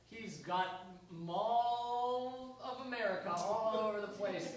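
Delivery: usual speaking voice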